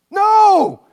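loud, high cry of dismay; horrified no. Pretend. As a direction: cry out as if the bulb is sure to fall. Imagined reaction to the bulb.

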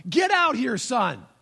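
"Get out here" called out high and loud, like a mom at the door; a mother shouting for her son; mock yell from a mother at the front door. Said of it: "mom yells".

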